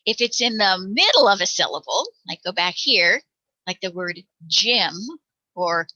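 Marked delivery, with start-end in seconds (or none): none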